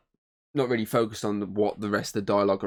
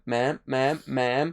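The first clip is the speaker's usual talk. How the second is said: lightly voiced female operator, rising; a hint of a phone dispatcher calling out; 3 semitones higher, much slower than usual. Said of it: "you can clearly hear a woman speaking back, going".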